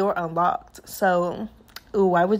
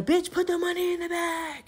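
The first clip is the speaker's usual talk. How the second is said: imitated robber yell; 7 semitones higher, 6 dB quieter than usual. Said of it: "the guy screaming".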